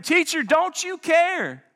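desperate disciples begging their teacher; begging call. Acted. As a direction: plead with him to wake up. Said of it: "they're calling and begging".

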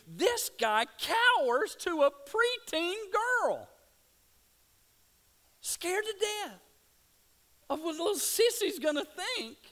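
loud, high-pitched, impassioned sermon delivery; full-force preaching. Real, not acted. Preacher to congregation on Peter cowering before a girl, full-force preaching.

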